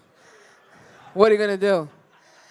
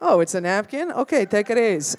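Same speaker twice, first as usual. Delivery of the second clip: ordinary talk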